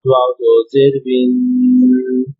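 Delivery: slow, drawn-out chant of letter and vowel names; teacher reads each syllable aloud, holding the nasal ends; slow Qaida recitation. Shown as a demonstration. Teacher reciting the Qaida lines to children, slow chant.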